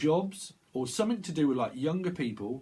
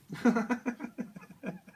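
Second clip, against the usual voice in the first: high-pitched burst of laughter; real high giggling laugh; 12 semitones higher than usual. Real, not acted. Laughs at imagined screaming listeners.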